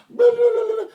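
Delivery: high, loud mock babble; blah blah babble. Mocking a chatterbox in a high shouting voice. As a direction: rattle off nonsense in a squeaky shout.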